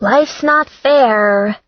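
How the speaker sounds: tartly cheerful comeback, dragged out; cheerfully acerbic. Acted. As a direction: redhead answers her friend's protest, cheerfully acerbic. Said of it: "in a cheerfully acerbic voice".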